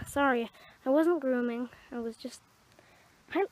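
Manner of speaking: ordinary talk, nothing marked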